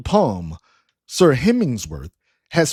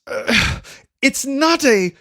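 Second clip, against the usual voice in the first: irritated; 12 semitones higher than usual. A narrator's reading. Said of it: "Starting in an irritated tone".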